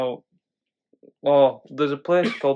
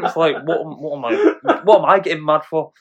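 normal talking voice

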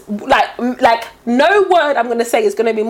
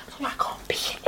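desperate stage whisper; 16 dB quieter than usual. Retold or imitated. Whispering to Sonia that she has to leave, mock-desperate whisper. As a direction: whisper it like a secret plea to escape.

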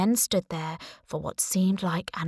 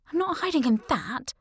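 higher, protesting; indignant refusal; 7 semitones higher than usual. A narrator's reading. Girl refusing to hide in the fish box, higher and protesting. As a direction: balk at the idea.

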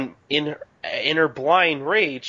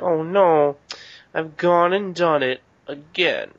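slightly higher, slow, hammed-up whimper; mock crying Kagura; 4 semitones higher, 3 dB louder, slower than usual. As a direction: retell her tearful wail lightly. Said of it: "she starts to cry".

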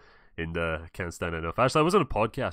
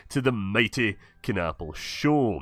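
host intro; 2 semitones higher, 5 dB louder than usual. Acted. Show host opening greeting.

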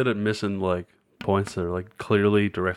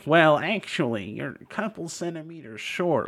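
higher-pitched mock pedant voice; nitpicking player; 5 semitones higher than usual. Mean tournament player correcting an opponent's measurement, mock pedantic. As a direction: correct them like an insufferable rules stickler. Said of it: "mean assholes that are just like".

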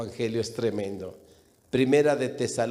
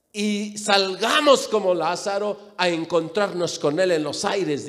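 raised, emphatic pulpit voice; preaching at full force; 9 semitones higher, 5 dB louder than usual. Real, not acted. Preacher urging believers not to fear dying, impassioned preaching. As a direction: drive the message home with rising fervour.

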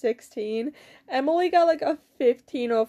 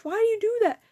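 high-pitched exasperated question; exasperated scolding; 8 semitones higher, faster than usual. Real, not acted. Scolding after finding it was Stitch.

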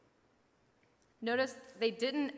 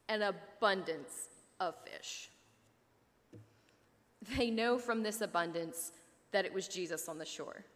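ordinary talk, nothing marked

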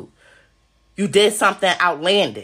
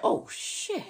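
breathless, hushed oh shit; mock scared gasp; 9 semitones higher, 10 dB quieter than usual. Imitating watchers scared by their phones, gasping. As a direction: gasp it out quietly.

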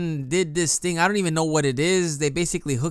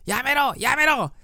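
high, pleading mock yells; imitating begging to stop; 11 semitones higher than usual. Spun patient begging staff to stop, high mock pleading. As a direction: beg them to stop in a strained high voice. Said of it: "And he's like".

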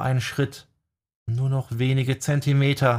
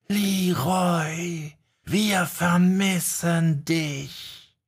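slow ghostly whisper growing more insistent; slow, distant spectral whisper rising in pitch; eerie whispering spirits; 6 semitones higher, much slower than usual. A narrator's reading. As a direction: lure him in, slow and haunting. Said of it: "flüsterten sie immer eindringlicher".

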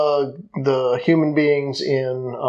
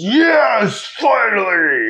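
triumphant shout; 7 semitones higher, much slower than usual. Retold or imitated. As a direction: burst out in triumph. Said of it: "Hulked his way out".

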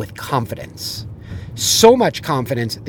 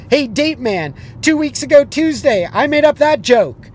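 lightly voiced, higher stage comic; a hint of a showman teasing the crowd; comedian's comeback; 11 semitones higher, 4 dB louder than usual. Comedian Howie ribbing the heckler onstage, lightly voiced.

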